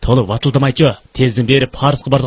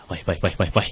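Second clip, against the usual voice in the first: low pained groan; 8 semitones lower, 10 dB quieter than usual. Acted. Moaning, head aches.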